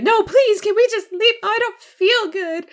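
put-on high, loud, pleading little-girl whine; whiny begging. Tresselaine begging Beckett to leave the mall, high whine.

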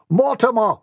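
high-pitched call of his name. Acted. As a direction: call the boy through the door. Butler calling the boy.

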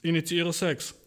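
slow mechanical status report; robot system voice. Retold or imitated. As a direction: announce it like a computer. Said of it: "so zwei so".

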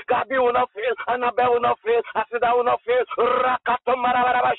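rapid-fire declarations on one driving note; insistent, chanted charismatic prayer; prophetic declarations. Real, not acted. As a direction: hammer out each line like a fervent preacher. Preacher chants prophetic declarations over children.

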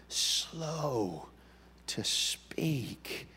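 softly, almost whispered; hushed slow to speak. Shown as a demonstration. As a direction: drop to a hush on to speak.

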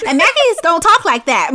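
ordinary talk, nothing marked

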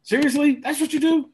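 high, loud, incredulous; exasperated outrage. Real, not acted. Cohost ranting about Sting's debut being hidden behind a screen, incredulous and loud. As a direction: throw the question up in disbelief.

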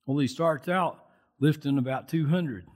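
usual speaking voice

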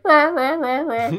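mock sad roar, pitched very high; sad monster. Godzilla complaining humans attack him, very high mock sad roar. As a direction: whimper it like a sulking monster. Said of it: "he sounds hella sad".

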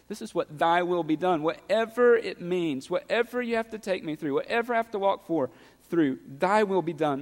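rising, pressing preacher's plea; building sermon intensity; impassioned preaching. Acted. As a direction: preach it with growing urgency. Preacher pressing the prayer home.

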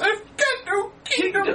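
high, slow tinny toy voice; like a pull-string toy; gruff tinny action figure. Retold or imitated. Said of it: "action figure that says".

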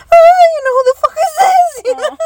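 high, laughing mock dog voice. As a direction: squeak it out like a startled little dog.

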